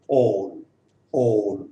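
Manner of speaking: all with a rounded, drawn-out aw; low, falling long aw in all; long vowel demo. Shown as a demonstration. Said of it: "और long sound".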